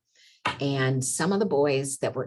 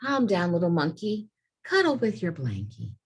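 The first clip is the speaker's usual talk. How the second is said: gentle, reassuring read-aloud; soothing storybook voice; 5 semitones higher than usual. A narrator's reading. Teacher soothing the sad monkey in the story, gentle.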